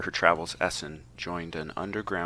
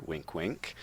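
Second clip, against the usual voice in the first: much softer sly knowing aside; spoken wink wink; 2 semitones lower, 10 dB quieter than usual. Acted. Narrator hinting again at the twist, soft sly aside. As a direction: whisper the hint with a wink. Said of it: "still a different character".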